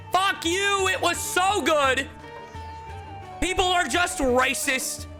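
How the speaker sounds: very high, drawn-out indignant cry; high, exasperated fan rant; indignant outburst. Acted. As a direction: cry out in high-pitched outrage. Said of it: "And I'm like".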